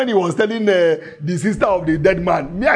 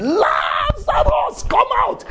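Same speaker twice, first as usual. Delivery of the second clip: Jesus calling Lazarus from the grave; shouted command; slower than usual. Acted. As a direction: bellow it like raising the dead. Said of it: "The man shouted".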